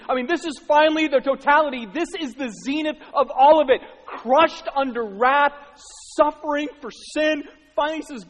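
high, loud sermon at full force; impassioned preaching. Real, not acted. Preacher on suffering under wrath, impassioned and loud. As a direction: drive each phrase home loudly.

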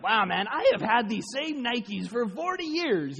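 drawn-out mock wonder; amazed. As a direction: marvel at the old Nikes. Imagined believer amazed at old sandals.

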